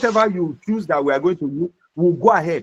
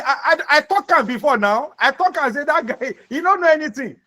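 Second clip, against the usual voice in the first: rude know-it-all; 8 semitones higher than usual. Retold or imitated. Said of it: "Or somebody will say".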